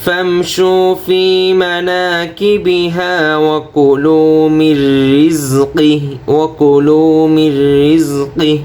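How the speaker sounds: slow melodic tajweed chant; Quran recitation. Shown as a demonstration. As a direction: sing the verses with held vowels. Model recitation.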